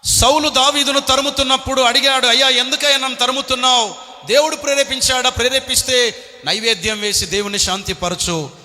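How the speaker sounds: loud, high impassioned sermon; fiery preaching. Real, not acted. Preacher retelling David's trials to the crowd, shouting. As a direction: preach it with full force.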